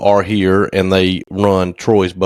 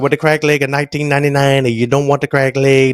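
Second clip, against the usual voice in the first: higher-pitched, rapid-fire mock Chinese accent; imitated Chinese accent; 5 semitones higher than usual. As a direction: rattle it off in a put-on Chinese accent. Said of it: "that's how he talked".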